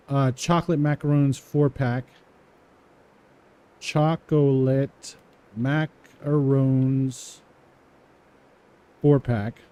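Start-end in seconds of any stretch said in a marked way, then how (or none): 3.8-9.6 each word drawn out slowly while typing; slow dictation. Shown as a demonstration. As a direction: spell it out slowly as you type. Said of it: "typing slowly after reading it".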